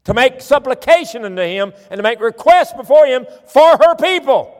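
high, loud preaching at full force; forceful preaching. Real, not acted. Preacher declaiming scripture to the congregation, full-force preaching.

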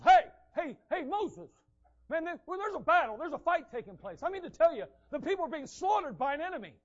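breathless lookout yelling about a battle; frantic shouting Joshua. Acted. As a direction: shout a panicked warning up to Moses. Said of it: "That's the [name] version".

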